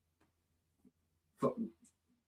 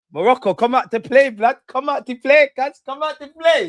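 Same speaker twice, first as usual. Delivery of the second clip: sing-song taunt; 8 semitones higher, 5 dB louder than usual. Real, not acted. Goading the opponents.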